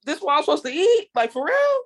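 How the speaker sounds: high, disbelieving grumbling voice; the people grumbling about the manna, high and incredulous; incredulous complaint. Retold or imitated. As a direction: sound like you can't believe this is your food. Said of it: "They was like".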